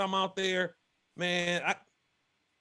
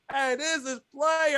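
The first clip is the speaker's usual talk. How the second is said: slow, drawn-out, savoring the words; impressed and cool; 6 semitones higher, slower than usual. Retold or imitated. Guy in a fishing kayak, slow, impressed drawl. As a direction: lean back and let it roll out slowly.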